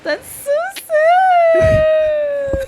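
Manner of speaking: very slow, high, loud wail; crying. Real, not acted. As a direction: sob it out, drawn long. Crying at the sad scene.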